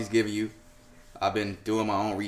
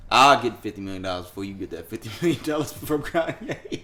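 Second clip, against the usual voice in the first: chuckling out the words, amused; laughing through it. Real, not acted. Laughing while teasing [name] about [name] money. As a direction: lets the laugh run into the line.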